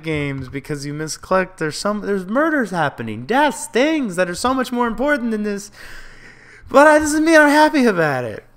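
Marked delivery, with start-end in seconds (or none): 1.6-8.4 rising higher and louder to a loud high peak; building exasperation. Real, not acted. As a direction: wind yourself up until the last line bursts out. Self-mocking rant about first world problems.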